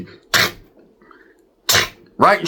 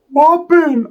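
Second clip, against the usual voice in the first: high mock Ricky; 10 semitones higher than usual. Ricky's retort, high voiced.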